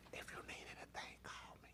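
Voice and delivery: hushed, voiceless mock-sympathetic whisper; dropped sympathy voice. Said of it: "drop your voice and do all that stuff".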